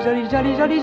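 ordinary talk, nothing marked

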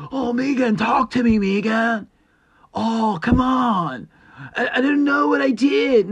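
high, loud whiny pleading of a name; Key and Peele sketch. Retold or imitated. Imitating the Key and Peele boyfriend pleading with Megan, high and whiny. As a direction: beg her to talk, hammed up. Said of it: "he's trying to get her to talk".

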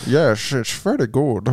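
put-on slow Dutch accent sliding into Sean Connery; attempted Dutch accent. Asked for his Dutch accent, slow Connery-like Dutch. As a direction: botch Dutch into a Connery-like slur. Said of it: "that's Sean Connery".

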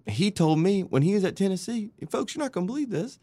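high-pitched, theatrical disbelief; mock-astonished retelling. Hyped-up retelling of unbelievable quote.

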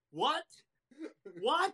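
puzzled wrestler squinting at a dictionary; imitating Tanaka. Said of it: "and says".

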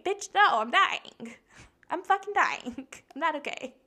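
high, loud comic outburst; dramatic retold meltdown with a laugh; mock despair. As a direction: exclaim it as hammed-up distress. Acts out her distressed answer.

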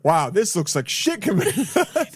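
laughing through the words; slow, giggling disbelief. Real, not acted. Laughing at the scarf.

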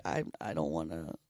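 low, sagging self-imitation; a mild, lightly voiced sulk; mopey mock quote. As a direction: mimic your own reluctant droop. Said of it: "So I was like".

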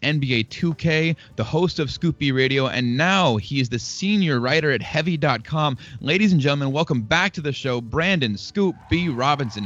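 announcer intro. Acted. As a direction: build up the guest like a ring announcer. Hypes up the guest intro.

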